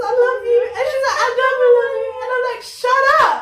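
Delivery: high-pitched, loud excited retelling; gushing mock exasperation. Friend retelling the couple's banter to her co-host, excited squeal. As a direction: squeal the lovers' banter, ending in an exclamation.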